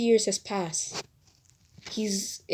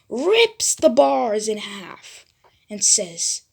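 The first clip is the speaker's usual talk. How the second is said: ordinary delivery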